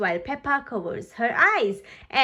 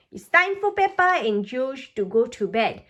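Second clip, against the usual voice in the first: usual speaking voice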